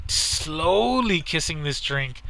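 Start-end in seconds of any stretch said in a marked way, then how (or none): 0.1-1.2 slow, lingering emphasis; drawn-out slowly. Retold or imitated. Storyteller describing a friend's reluctant sipping, slowly drawn out. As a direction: stretch the first syllable of slowly right out.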